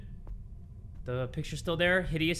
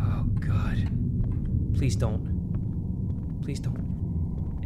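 hushed, dreading whispered plea; pleading whisper; 7 semitones lower, much slower than usual. Real, not acted. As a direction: beg under your breath in fear.

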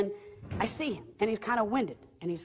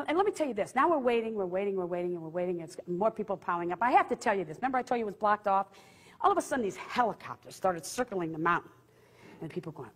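normal talking voice

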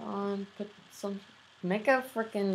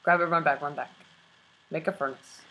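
ordinary talk

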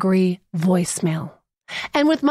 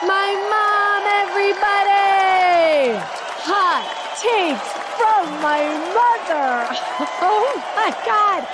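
high, drawn-out hype introduction; giddy, high-pitched whoop of pride; elated announcer shout; 8 semitones higher, slower than usual. Real, not acted. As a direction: present her to a cheering crowd. Host hypes mom after hilarious call.

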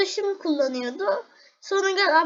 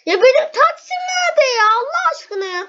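much higher and louder, near tears; panicked cry; 8 semitones higher, 8 dB louder than usual. Real, not acted. Gamer to viewers as the taxi vanishes, panicked loud wail. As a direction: wail over the lost taxi.

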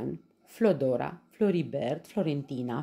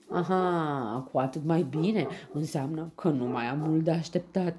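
pleased, louder and higher royal lion; happy lion; 5 semitones higher, 4 dB louder than usual. A narrator's reading. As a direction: gloat happily as the lion king. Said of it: "spune fericit maestatea sa leul". (leu fericit, încântat)

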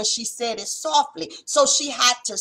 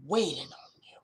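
sudden whisper; 7 semitones lower, 8 dB quieter than usual. Acted. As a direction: drop to a hushed whisper, much lower and softer. Preacher drops to a whisper.

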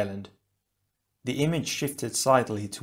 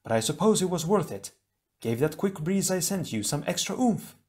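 put-on higher, breezy young voice; Leiwa's voice; 7 semitones higher than usual. Leiwa apologising to his brother after the rough landing, light and higher.

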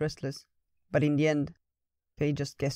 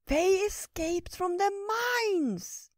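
earnest squirrel; much slower than usual. Acted. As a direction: confide like a little squirrel pleading for a friend. Squirrel Ratatosk confides.

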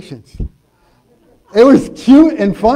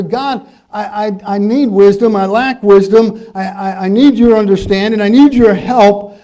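pleading prayer; 6 dB louder than usual. Acted. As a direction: cry out to God for wisdom. Models prayer to God.

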